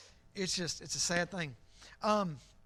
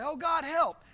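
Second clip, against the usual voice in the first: high, loud plea to God; mock cry for help; 9 semitones higher, 10 dB louder than usual. Preacher mimics wayward believers, loud high cry for help. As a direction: cry out like someone suddenly in trouble.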